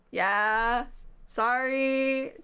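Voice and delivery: normal talking voice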